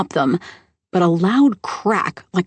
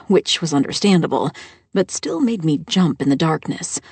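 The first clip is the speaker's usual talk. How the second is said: ordinary delivery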